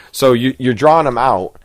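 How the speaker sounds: ordinary talk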